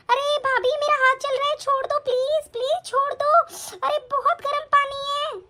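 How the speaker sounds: high, urgent begging to be let go; pained pleading. Acted. Sister-in-law begs to have her hand released, pained pleading. Hand forced into hot water.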